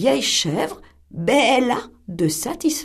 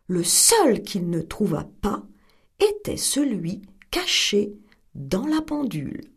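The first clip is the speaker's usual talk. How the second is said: ordinary delivery, nothing marked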